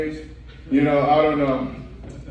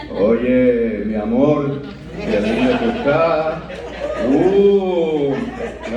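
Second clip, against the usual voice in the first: smooth seducer who slips up and recovers. Retold or imitated.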